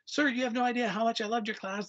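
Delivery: high, gushing rush of praise; gushing student. Retold or imitated. Grown student gushing to his old teacher, high excited voice. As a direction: pour it out like a starstruck fan. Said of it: "he's going on this rant".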